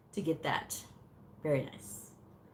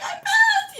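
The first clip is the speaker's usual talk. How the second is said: very high, loud squeal with laughter; hammed-up joyful shriek; ecstatic retold squeal; 7 dB louder than usual. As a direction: squeal it, laughing, in acted triumph. Retold joy at passing exam.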